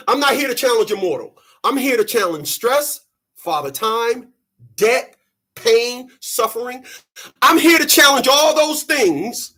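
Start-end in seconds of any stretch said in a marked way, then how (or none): none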